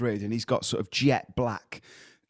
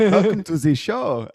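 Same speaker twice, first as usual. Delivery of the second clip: higher, louder, slow hammed-up German accent; caricature German accent; 7 semitones higher, 6 dB louder, slower than usual. Repeating his audition line to co-host, cartoonish German accent. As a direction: belt it out as an over-the-top German show host. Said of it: "caricature of a German accent".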